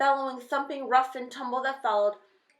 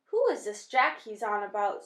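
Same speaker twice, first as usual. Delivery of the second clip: ordinary delivery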